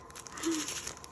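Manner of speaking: airy gasp of delight. Real, not acted. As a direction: gasp softly at something cute. Delighted by the little shakers.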